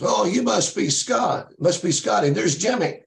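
put-on high-pitched, excited old-man greeting. Acting the man answering the door.

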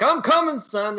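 high, heroic rescue call; heroic dad cry. Pretend. Friend pretending to be a heroic dad rushing to save his son, high heroic call. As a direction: play the dashing hero dad. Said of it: "be like".

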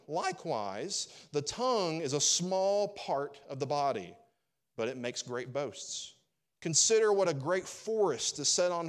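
loud, slow, emphatic pulpit reading; forceful, measured Bible reading; scripture reading. Acted. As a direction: proclaim the verses, stretching the key words. Reads scripture aloud from James.

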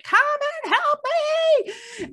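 high, long drawn-out mock scream; screaming for help. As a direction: wail it out like a fallen man calling for help. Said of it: "He's screaming by now".

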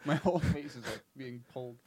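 quiet, pained laugh-talk; laughing through pain. Real, not acted. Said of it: "it hurts to laugh".

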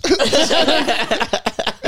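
cracking up; hearty laugh. Real, not acted. Comic laughing at his own story of the gig.

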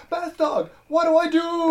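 very high, loud plea; mock panicked cry. Cody begging Math Dog for help, mock panic. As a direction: wail for help, hammed up. Cody's hand melting, cries for help.

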